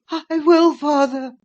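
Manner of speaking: high, breathless, out of breath plea; panting. Acted. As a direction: gasp it out, panting. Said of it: "he panted".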